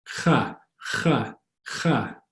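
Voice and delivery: "Cha" scraped from the back of the throat; raspy back-of-throat k in "cha"; throaty K. Shown as a demonstration. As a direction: say "cha" like clearing something out of the throat. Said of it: "it's more in the back of your throat".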